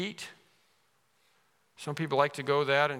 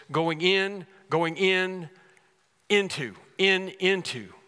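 "going in" pushed higher and louder; stress on IN; 4 semitones higher, 3 dB louder, slower than usual. Shown as a demonstration. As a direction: punch "in" and "into" repeatedly. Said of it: "the point is going in".

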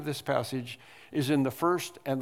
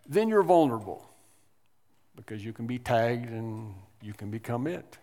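normal talking voice

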